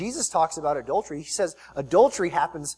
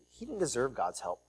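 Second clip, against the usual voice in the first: ordinary talk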